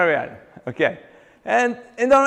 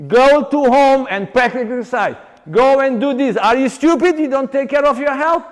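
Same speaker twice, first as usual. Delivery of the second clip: loud, higher scolding lecture; bossy expert; 7 semitones higher, 7 dB louder than usual. Retold or imitated. Trainer mocks experts scolding a traumatized client, loud and high. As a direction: bark orders like a careless expert. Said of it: "Going to a person like this".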